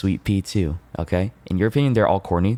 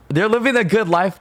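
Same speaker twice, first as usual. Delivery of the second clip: excited; 13 semitones higher, 4 dB louder than usual. Real, not acted. Reassures about his pet rats.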